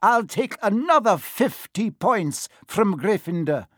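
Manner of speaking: high, indignant teacher's scolding; outraged telling-off, key words drawn out; losing her temper. A narrator's reading. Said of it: "she lost her temper".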